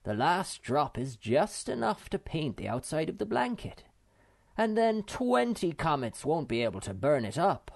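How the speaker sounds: triumphant. A narrator's reading. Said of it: "waved it triumphantly".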